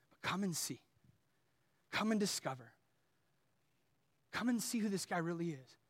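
low, softening plea to a doubting friend; hushed, patient persuasion; gentle reassurance. Acted. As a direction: acknowledge the doubt, then invite very softly.

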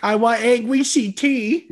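high, slow whiny demand; mock whiny demand. Real, not acted. Demanding angry CT from his cohost, mock whine.

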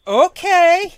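high, loud, rising okay; mock bemused okay. Storyteller watching junker leave up alley, bemused high okay. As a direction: draw back with a rising pitch.

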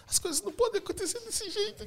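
high-pitched mock tearful whine; hammed-up weepy protest in a high voice; imitated crying wife. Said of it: "Meu, a Kate chorando".

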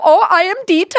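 high, loud cartoon German accent; fake German accent. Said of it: "they always go".

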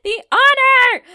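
very high, loud, slow mock gasp of honor; grand exclamation. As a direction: proclaim it like a swooning fan. Mock-grateful reply to segment name.

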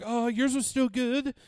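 high, loud, slow mock-Swedish lilt; exaggerated singsong Swedish accent; weird Swedish accent. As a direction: put on a silly Swedish accent, drawn out. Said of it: "giving this weird Swedish accent to Japanese people".

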